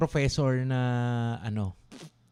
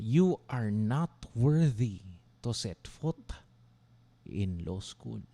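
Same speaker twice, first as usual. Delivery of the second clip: slow hushed repeat; 4 semitones lower, 3 dB quieter, much slower than usual. Retold or imitated. Repeats the insult slowly.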